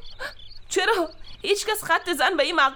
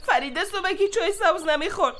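ordinary talk, nothing marked

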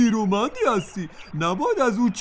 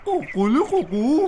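rooster crow; 4 semitones higher than usual. Acted. Rooster crows to turkey.

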